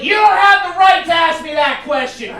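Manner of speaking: high, loud outraged yelling; losing it. Retold or imitated. Customer to a checker who greeted him, furious mock shouting. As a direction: blow up at a harmless question. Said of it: "this fucker lost it".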